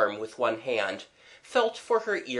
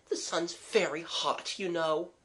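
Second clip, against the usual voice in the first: soft, higher-pitched explanation; soothing girl; 6 semitones higher, 5 dB quieter than usual. Acted. Mabel blaming the sun to soothe him, gently. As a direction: gently offer an excuse, softly.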